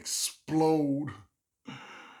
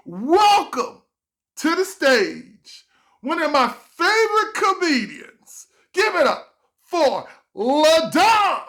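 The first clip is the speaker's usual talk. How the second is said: high loud slow announcer; emcee intro; 12 dB louder, slower than usual. Retold or imitated. Host bringing him on stage, slow loud announcer.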